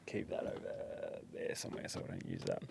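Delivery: quick hushed aside; whispering. Real, not acted. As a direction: whisper it off the record. Off-mic aside to cut.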